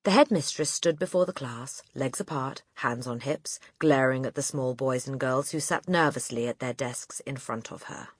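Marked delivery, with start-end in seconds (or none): none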